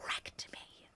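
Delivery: breathy whispered echo of the words. Real, not acted. Whispering to chat how hard the ending hit. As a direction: let it fall to a near-silent whisper. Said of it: "whispered after saying it wrecked".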